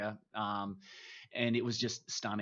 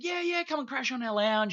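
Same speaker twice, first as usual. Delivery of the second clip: higher, easygoing imitation of a mate; friendly mate offering a couch; 13 semitones higher than usual. Mates in Edinburgh inviting him over, breezy higher voice. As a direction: toss off a casual Aussie invite in a raised voice.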